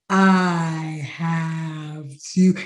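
very slow drawn-out "I have to"; stretched out slowly. Retold or imitated. She mimics how slowly she told the AD she had to go. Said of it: "it felt like I said".

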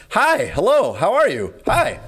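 high, swooping, overdone hello; very exaggerated greeting. Customer waving at an ignoring barista, very exaggerated. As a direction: greet him as if hailing someone oblivious. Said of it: "ex-, very exaggeratedly, like, waving to him".

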